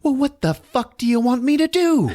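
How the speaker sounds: high-pitched frantic snapping; hammed-up shrieky frustration; mock exasperation. [name] to helpless Frank, mock exasperation.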